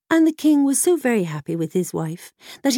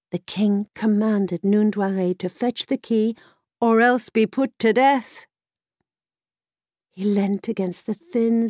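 plain reading voice

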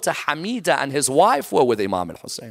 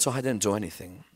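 hushed denial; 7 semitones lower, 11 dB quieter, faster than usual. Acted. Stranger answers the angry mother.

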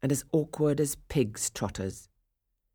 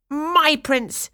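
higher and louder possessive stepsister; spat out; 12 semitones higher, 7 dB louder than usual. Acted. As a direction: spit it out at her sister. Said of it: "Krivlayaka spat out".

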